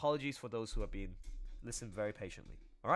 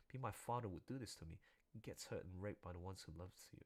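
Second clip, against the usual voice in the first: low, soft reading of a victim's words; reading a statement; 5 semitones lower, 5 dB quieter than usual. Acted. Streamer reads the girl's statement aloud, soft and solemn. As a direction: read it quietly and somberly.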